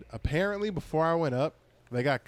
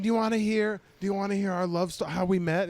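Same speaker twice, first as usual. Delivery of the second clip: a hint of an eager drunk woman, higher; mock drunk heckler; 7 semitones higher than usual. Drunk woman offering her love story, mock eagerness. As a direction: lightly imitate an insistent heckler.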